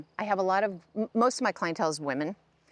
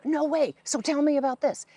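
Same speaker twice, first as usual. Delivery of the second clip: excited disbelief; 7 semitones higher, 3 dB louder than usual. Retold or imitated. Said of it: "they're going".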